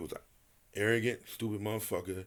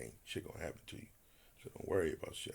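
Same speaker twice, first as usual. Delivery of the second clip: hushed reassurance; 7 semitones lower, 13 dB quieter, faster than usual. Retold or imitated. Reassuring Ted quietly.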